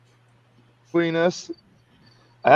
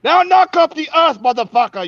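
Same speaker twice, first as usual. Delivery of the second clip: put-on Ugandan accent; 11 semitones higher, 5 dB louder than usual. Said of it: "'cause he had a Ugandan accent".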